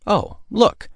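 high, bright, delighted cry; light up at the sight; brightened. Acted. Girl spotting elephants, brightening with delight. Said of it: "she brightened".

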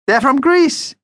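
very high, thrilled little vampire; excited. A narrator's reading. Vampire shares news of the shopkeepers with Mum, excited. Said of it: "he told Mum excitedly".

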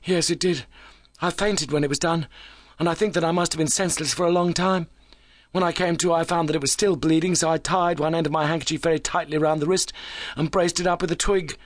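high, animated character voice; keyed-up injured visitor. A narrator's reading.

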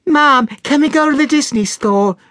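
put-on high-pitched child pleading with Mom; little girl pestering her mother; high, wheedling child voice; very high girl's voice. A narrator's reading. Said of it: "in a very high voice".

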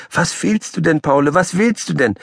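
puzzled high question; high patient woman. Acted. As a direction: ask a pestering pet kindly. Petersilie asks Paule.

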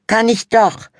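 put-on high, loud teen girl insisting; bickering sister. A narrator's reading. Sister bickering with brother, high and loud. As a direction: stamp the foot on doch.

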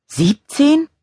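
high, rising disbelieving question; incredulous. A narrator's reading. Charlotte seeing the count of missed calls, incredulous. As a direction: exclaim it in sheer disbelief. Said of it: "rief sie ungläubig".

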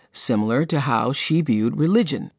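ordinary talk, nothing marked

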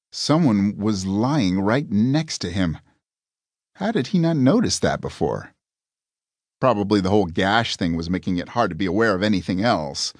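ordinary delivery, nothing marked

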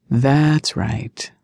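understated, unbothered reply; smooth, nonchalant. A narrator's reading. Rescuer admits to stalking Mia, completely nonchalant. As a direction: admit it smoothly, without any concern. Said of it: "His reply was smooth and completely nonchalant".

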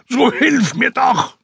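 screaming call from a sinking man; desperate shout. Acted. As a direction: yell across the water. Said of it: "tauchte auf und schrie".

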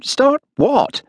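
very high-pitched, puzzled query; startled question. A narrator's reading. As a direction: ask in startled bafflement, pitch jumping up. Startled woman questions his huffy remark.

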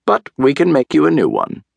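high, matter-of-fact doctor's announcement; announcing. A narrator's reading. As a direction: announce the remedy in a lifted voice. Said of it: "Doctor DeSoto announced".

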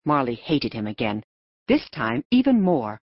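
plain reading voice

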